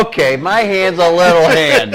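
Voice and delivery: high and loud, on the edge of laughter; loud, amused concession. Real, not acted. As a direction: give in noisily to the teasing. Teasing about his small hands.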